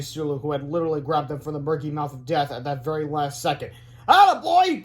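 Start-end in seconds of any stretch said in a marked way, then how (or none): 4.1-4.8 high, loud whoop; cheering. A narrator's reading. Boy cheers rescuing hamster, high and loud. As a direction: cheer out in delight. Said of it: "cried George".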